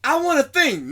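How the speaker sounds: put-on eager boy begging his dad; wholesome 1950s kid.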